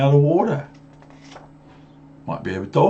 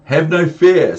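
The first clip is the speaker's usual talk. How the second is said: booming cat; 7 semitones higher, 8 dB louder than usual. Acted. Cat reassures.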